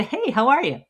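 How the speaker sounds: friendly greeting. Shown as a demonstration. Shop owner greets a new customer, cheerful. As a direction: welcome them warmly at the door. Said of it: "Just a".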